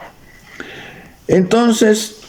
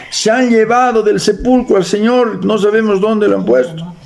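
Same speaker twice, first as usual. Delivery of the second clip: stretched, high-pitched report; urgent announcement; 8 semitones higher than usual. A narrator's reading. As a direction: proclaim the news on a raised pitch. Reads Mary's alarmed report aloud.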